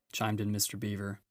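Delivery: ordinary delivery, nothing marked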